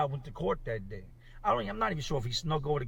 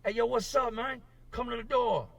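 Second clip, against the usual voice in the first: calling out; 9 semitones higher than usual. Retold or imitated. Inmates calling through cell doors.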